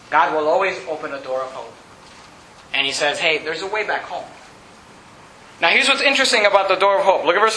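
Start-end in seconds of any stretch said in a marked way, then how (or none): 0.1-4.3 tender reassurance. Acted. Preacher softly offers God's welcome.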